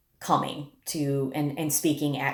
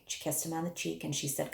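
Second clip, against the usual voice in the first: usual speaking voice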